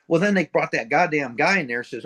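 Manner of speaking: ordinary talk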